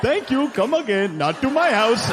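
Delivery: mock Indian accent. Son imitating his absent father, mock Indian accent. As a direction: put on a stock Indian accent for dad. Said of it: "a fun racist impression of him".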